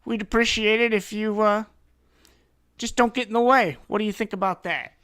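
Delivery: loud, high-pitched forceful brush-off; pushy detective warning. Acted. Detective telling heroes to stay out, forceful warning.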